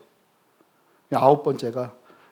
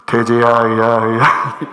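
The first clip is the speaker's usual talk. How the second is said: put-on slow, loud, booming old-man God voice; stern grandfather God hollering slowly; old bearded God calling; 6 semitones lower, 10 dB louder, much slower than usual. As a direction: drag out a distant patriarch's call. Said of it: "하나님 말씀하실 때 이럴 것 같아요".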